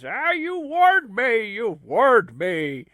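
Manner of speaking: high, slow wail of regret; mock anguish. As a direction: drag out the regret like a wounded hero. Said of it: "I warned you about character changes".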